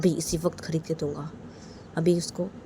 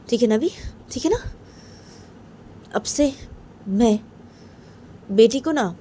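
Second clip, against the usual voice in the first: angry mother; 2 semitones higher, 4 dB louder than usual. Acted. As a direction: throw the words at the husband in a sulk. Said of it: "देखते हुए बोलती कि".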